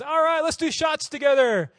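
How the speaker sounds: loud, high, drawn-out party cheer; hammed-up, slow, jubilant yell; rowdy invitation. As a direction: shout it like an exuberant friend rallying everyone. Acting out friend's rowdy invitation.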